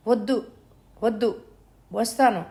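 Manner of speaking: a touch of a frightened cry; mild cry. Acted. Mother pleading with kidnapper on phone, mild cry. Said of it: "అరిచింది సావిత్రి".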